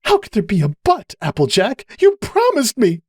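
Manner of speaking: high, distraught voice; upset accusation. A narrator's reading. Cupcake accusing Applejack, high and distraught. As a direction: climb high with hurt.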